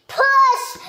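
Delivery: high, loud cheer of push; shout. Real, not acted. Parent and child pushing the button together, shouting. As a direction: call it out with glee.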